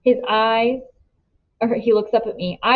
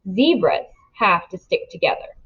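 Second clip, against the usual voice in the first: ordinary delivery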